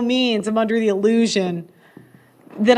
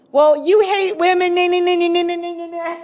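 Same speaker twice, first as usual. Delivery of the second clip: higher-pitched mock nagging, fading out; mocking whine; 5 semitones higher than usual. Woman griping at men, mimicked as a mocking whine. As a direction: mimic a complainer trailing into babble.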